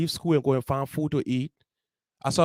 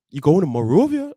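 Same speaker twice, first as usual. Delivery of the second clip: louder, startled question; mom's surprised echo; 7 dB louder than usual. Retold or imitated. Mom startled her son is leaving, louder question. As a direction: echo it back in mild disbelief. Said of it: "My mom like".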